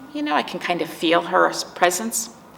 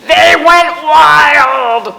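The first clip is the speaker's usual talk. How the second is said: builds to a big, much louder, higher and very slow burst on "wild"; they went wild; 8 semitones higher, 14 dB louder, much slower than usual. Acted. Teacher reading to children, the cats' wild moment, loud and much higher. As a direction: throw the word out like the cats are running loose.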